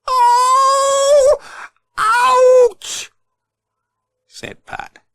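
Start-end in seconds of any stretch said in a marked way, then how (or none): 0.1-3.1 pained cry. Acted. Pat hit by falling tin.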